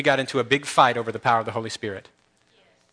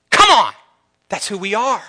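high, loud rallying yell; real preacher's outburst; 6 semitones higher, 7 dB louder than usual. Real, not acted. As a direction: burst out fired up, then drive it home. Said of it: "Sorry about the yelling there".

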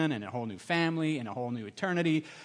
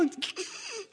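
high giggle; 8 dB quieter than usual. Retold or imitated. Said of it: "he's like".

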